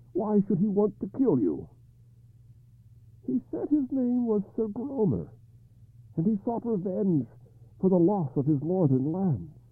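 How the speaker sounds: high, troubled king; King Arthur voice. Acted.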